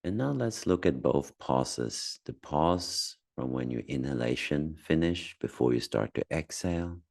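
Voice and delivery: calm, spacious delivery with long silences; unhurried meditation voice, phrase by phrase; guided meditation. Acted. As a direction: gently guide someone through noticing their breath. Leads a guided breathing meditation.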